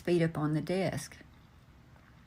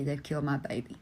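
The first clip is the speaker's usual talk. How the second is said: softer, sinking into quiet heartbreak; quiet grief; 2 semitones lower, 4 dB quieter than usual. Retold or imitated. A bereaved father quietly repeating his grief, hushed. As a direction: let the repeat fall away, broken.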